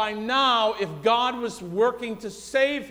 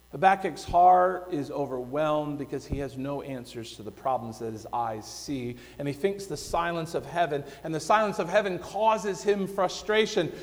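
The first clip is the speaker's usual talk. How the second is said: normal talking voice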